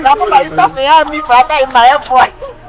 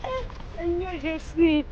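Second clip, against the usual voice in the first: crying; 17 dB quieter, slower than usual. Real, not acted. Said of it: "Non, maman, faut pas pleurer".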